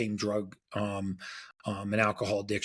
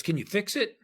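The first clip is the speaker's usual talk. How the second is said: eager question; 12 semitones higher than usual. Retold or imitated. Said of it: "he goes".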